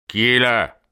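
mildly hostile shouted question; unfriendly call. A narrator's reading. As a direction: call out sharply to a stranger. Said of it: "cria une voix peu avenante".

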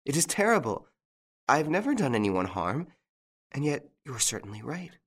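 high, distressed pleading voice; terrified. A narrator's reading. Jeanne protests her innocence to Lupin, in terror. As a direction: raise the pitch in mild horror. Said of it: "distorted with terror".

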